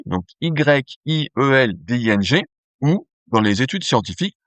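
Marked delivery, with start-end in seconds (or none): 0.0-2.4 Y I E L D I N G Buchstabe für Buchstabe langsam buchstabiert; spelled out. Shown as a demonstration. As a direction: spell Y I E L D I N G out slowly, letter by letter. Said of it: "isométrie yielding".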